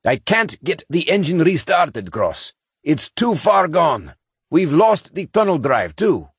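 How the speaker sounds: put-on high old engineer over a crackly intercom; scratchy intercom. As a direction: sound like a voice through a scratchy intercom. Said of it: "The scratchy intercom sounded with Janek's voice".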